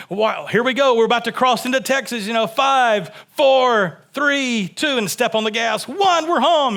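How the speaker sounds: put-on dad counting the kids down to the Texas border, excited and climbing; excited countdown. Family countdown crossing into Texas.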